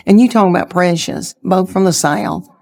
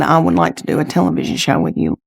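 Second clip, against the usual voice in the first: low, quick, lightly voiced producer; lightly voiced Chuck Lorre; 7 semitones lower, faster than usual. Producer offering her a show, low and quick. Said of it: "and said".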